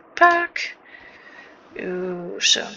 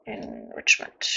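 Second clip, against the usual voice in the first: deep announcer voice; slower than usual. Pretend.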